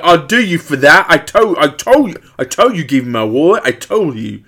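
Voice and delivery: put-on high, loud British robber accent; British thug impression. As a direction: threaten in a rough mock-British accent. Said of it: "that is kinda how he sounded".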